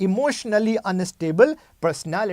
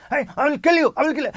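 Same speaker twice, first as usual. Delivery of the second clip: higher, louder mock threat; sudden rage; 6 semitones higher, 4 dB louder, much faster than usual. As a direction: snap into a shouted threat.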